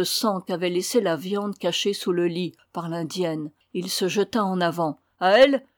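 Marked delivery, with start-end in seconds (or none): 5.2-5.6 high, loud scornful question; acted indignant outcry; exclaiming. A narrator's reading. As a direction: cry out in disbelief. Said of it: "s'écria-t-il".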